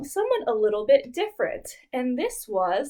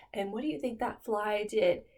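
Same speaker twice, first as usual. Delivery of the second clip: ordinary delivery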